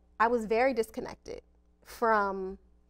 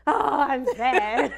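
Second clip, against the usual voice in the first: laughing realization; 5 semitones higher, 7 dB louder, much slower than usual. Retold or imitated. Retold realization of crying, laughing.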